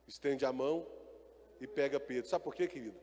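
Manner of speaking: ordinary talk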